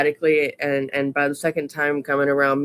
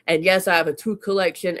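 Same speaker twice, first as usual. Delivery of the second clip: usual speaking voice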